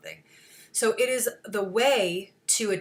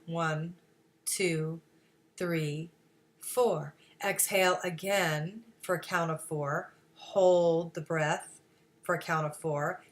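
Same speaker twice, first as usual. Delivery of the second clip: soft, low, unhurried breathing count; guided breathing; 3 semitones lower, 4 dB quieter than usual. Shown as a demonstration. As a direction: lead the breath gently with spaced-out phrases. Leading a breathing exercise by count.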